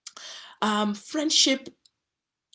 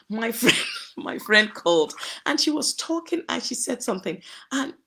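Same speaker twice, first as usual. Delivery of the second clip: real laughter; 3 semitones higher, 4 dB louder than usual. Real, not acted. Recalling friend's hilarious phone call, laughing. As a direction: laugh breaking into the words.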